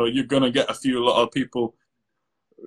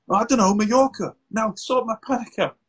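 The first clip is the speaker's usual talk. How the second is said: higher, flustered and demanding; mock flustered client; 8 semitones higher than usual. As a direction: play the client blurting then demanding relief. Acted client answering while panicking.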